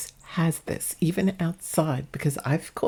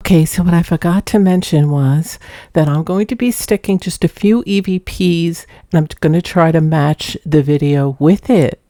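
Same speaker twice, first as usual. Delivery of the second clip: much louder added narration; loud voiceover; 11 dB louder than usual. Real, not acted. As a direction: say it loudly, close to the mic.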